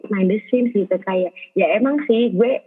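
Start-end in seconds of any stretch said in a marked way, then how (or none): none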